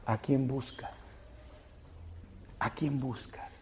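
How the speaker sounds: soft, far-off repeated question; hushed question. Acted. Christ asking Mary at the tomb, quiet and intimate. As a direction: ask it gently, almost under the breath.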